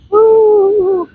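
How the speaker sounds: long, high, loud "woo"; excited whoop. Real, not acted. Vlogger at the stormy beach, high excited whoop.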